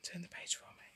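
hushed whisper to a little helper; whispered aside. Real, not acted. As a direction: whisper it privately, under the story.

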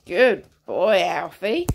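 ordinary talk, nothing marked

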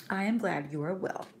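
earnest read-aloud narration with voiced characters; story reading. Teacher reading a moral story to her class, expressive. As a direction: read the old tale to the class, lively.

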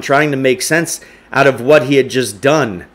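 ordinary delivery, nothing marked

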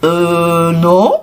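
hesitant, drawn-out answer; sheepish stammer. A narrator's reading. Cornered wolf answering the goat, stammering. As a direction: falter before answering. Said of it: "bredouilla Lou".

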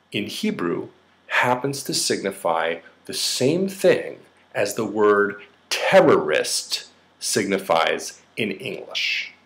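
ordinary talk, nothing marked

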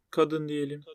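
usual speaking voice

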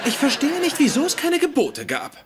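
high puzzled wondering; baffled. Real, not acted. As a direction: sound genuinely puzzled. Baffled at the bargain.

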